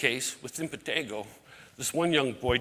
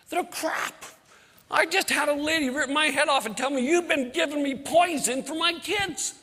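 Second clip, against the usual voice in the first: high, loud acted outrage; indignant complaint; 11 semitones higher, 5 dB louder than usual. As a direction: retell an angry telling-off, pitched way up. Indignantly confronting Bernie over bad cookies.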